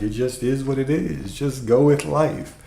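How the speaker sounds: dreamy mock-guru shrug, drawn out; mock zen guru. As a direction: play a lazy zen master shrugging it all off. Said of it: "or else I'm just gonna be like".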